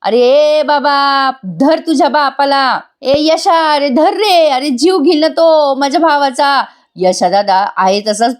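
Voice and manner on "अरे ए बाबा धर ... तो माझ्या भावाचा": high, mock panicked yell; aunt shouting. Aunt begs Yasha to hold his father, mock shouting. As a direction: shout for help to stop him. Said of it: "आरडाओरडा करत असते".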